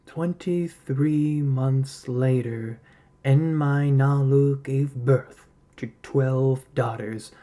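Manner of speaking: plain reading voice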